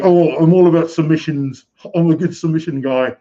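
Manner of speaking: mimicked British accent, high and forceful. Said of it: "he talked in a British accent".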